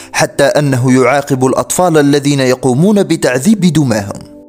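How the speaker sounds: plain reading voice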